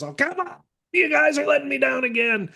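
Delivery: high, exasperated moaning fan voice; whiny fan complaint. Retold or imitated. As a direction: whine it like a let-down fan. Mocking frustrated Red Sox fans.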